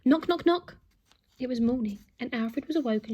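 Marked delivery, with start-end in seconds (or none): 0.0-0.8 punchy, loud knock-knock-knock; loud knocking sound. A narrator's reading. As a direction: bang out each knock. Narrator voices knocking sound.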